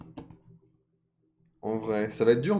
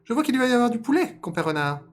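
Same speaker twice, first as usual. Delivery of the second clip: high, loud, quick squeal; rabbit speaking aloud; 8 dB louder than usual. Acted. Rabbit addressing fox about chicken, high loud voice. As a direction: sing out brightly for the fox to hear. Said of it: "Et à voix haute".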